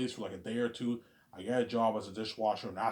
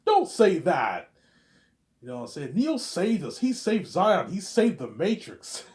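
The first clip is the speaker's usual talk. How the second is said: much higher, louder defiant cry; fervent shout; 10 semitones higher, 12 dB louder, slower than usual. Shown as a demonstration. Vijay defends Neo, loud high fervent cry. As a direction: shout it like a true believer. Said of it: "repeated retelling".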